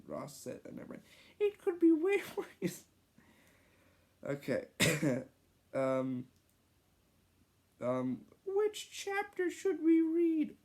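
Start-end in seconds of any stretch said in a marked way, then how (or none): 1.4-2.8 put-on high, fast, laughing child voice; laughing kid voice. Ross voice, laughing. 8.5-10.5 put-on kid voice. Ross kid voice.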